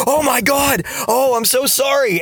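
high, horrified gasping sorry; aghast at what he just did; shocked apology. Retold or imitated. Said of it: "his jaw drops".

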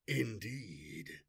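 low, cold, disdainful reply; disdainful warlord. Acted. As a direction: say it with icy contempt. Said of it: "in a disdainful voice containing less warmth".

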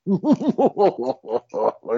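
hammed-up villain cackle; villain's laugh. Culprit revealed in a mystery play, mock-evil laugh.

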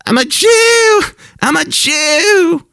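high, strained mock scream, drawn out at the end. Preacher imitating Zelensky's speech, high mock screaming. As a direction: shriek it like a ranting leader, stretching the last one. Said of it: "he started screaming".

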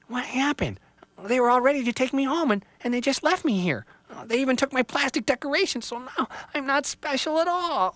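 put-on high, hurt young tree voice; a small tree on the verge of tears; upset little tree. Little Tree left behind, high and dismayed.

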